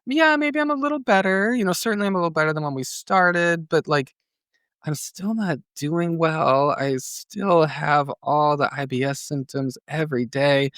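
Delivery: high, whiny retelling of own complaint; pleading patient. Retold or imitated. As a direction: imitate yourself begging the doctor, pitched high. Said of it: "told him".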